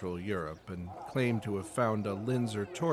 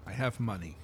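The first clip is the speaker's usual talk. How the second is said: normal talking voice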